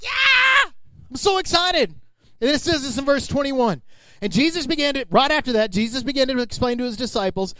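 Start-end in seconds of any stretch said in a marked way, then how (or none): 0.0-1.9 high squealed yeah, then gushing; giddy Peter. Retold or imitated. Peter thrilled with his new name, high mock glee. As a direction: overdo the joy like a kid.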